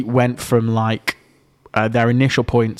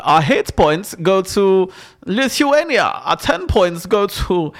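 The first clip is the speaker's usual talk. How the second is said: slow, high German points announcer; comic German spokesperson, drawn out; German accent; 6 semitones higher, slower than usual. Acted. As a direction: spin out the votes in a mock German accent. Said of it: "it's a great German accent".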